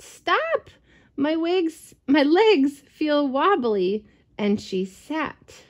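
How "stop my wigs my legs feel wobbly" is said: sudden high cry, then wobbly pleading. Acted. Cow at the bottom of the stack crying out as her legs give. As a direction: yelp to halt the pile. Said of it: "cried out".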